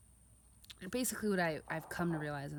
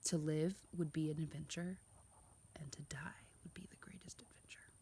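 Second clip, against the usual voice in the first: soft, hushed quote trailing into a whisper; hushed Peter Pan quote; 6 dB quieter than usual. Retold or imitated. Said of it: "like Peter Pan said".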